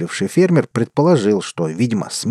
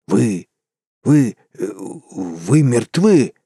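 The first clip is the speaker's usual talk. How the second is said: slow, halting, terrified stammer; stammering in fright; much slower than usual. Acted. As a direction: choke the words out in terror. Doctor faces dead man returned.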